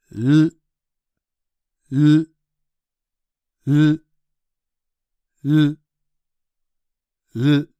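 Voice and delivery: isolated L consonants, held firm; English L sound demo. Shown as a demonstration. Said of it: "舌を上顎にピタッとつけたまま発音します". (舌を上顎につけたままの「ル」)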